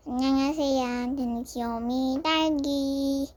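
squeaky cute creature talk; breathy cutesy giggly. Pretend. Voicing the pet lizard.

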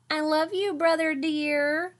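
high, slow teasing sing-song; sugary little sister. A narrator's reading. Sister teasing Brother, high sugary voice. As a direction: pour on the sweetness to needle him.

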